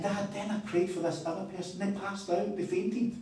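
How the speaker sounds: put-on high, eager quote of a boy's report; excited son, imitated. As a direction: imitate an amazed kid telling dad. Acts out son's amazed report.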